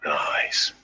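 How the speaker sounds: soft breathy whisper, no voice. Real, not acted. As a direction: whisper it like a secret. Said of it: "Why are we whispering?".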